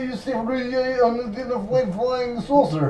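high, slow hammed-up Russian accent; mock Russian accent. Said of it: "practice Russian accent".

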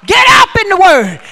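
loud preaching shout; shouted command. Real, not acted. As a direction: call it out hard.

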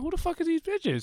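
high-pitched, replayed disbelief; retold bafflement. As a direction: act out being baffled by strangers. Retold baffled reaction to the girls.

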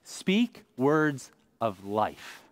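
very slow, weighted phrase; unhurried, deliberate preaching line; slow emphasis. Shown as a demonstration. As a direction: lean on each word like a command. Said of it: "This is insane".

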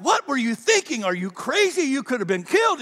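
a touch of a frantic mom; mother scolding. Retold or imitated. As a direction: play the worried mom telling him off. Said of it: "my mom's like".

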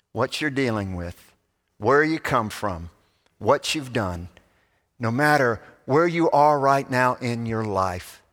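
usual speaking voice

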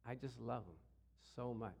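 a hushed, loving answer from God; tender reply. Retold or imitated. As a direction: say it gently, as God answering with love. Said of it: "God said".

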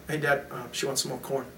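soft, low, understated retelling of a son; quiet aside. Retold or imitated. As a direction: mention it softly to dad. Son quietly interpreting for sister.

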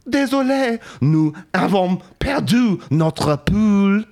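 high, loud, slow French apology; squeaky French voice, drawn out; French accent. Acted. French triplets reply again.

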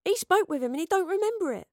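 high-pitched frustrated protest; agitated accusation. A narrator's reading. Astrid accuses Gaius.

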